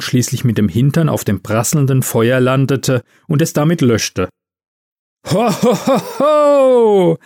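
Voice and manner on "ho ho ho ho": high whooping ho-ho-ho, last one held long; happy bellowing Santa. A narrator's reading. Santa landing in the theatre fireplace, bellowing happily. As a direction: bellow a merry, tipsy Christmas laugh. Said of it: "gröhlte er glücklich".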